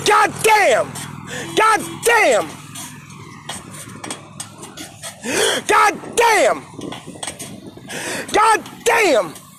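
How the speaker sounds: high, sharp curse bursts; frustrated cursing. Real, not acted. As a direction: spit out each curse in pure exasperation. Repeated frustrated curses, flood and toilet.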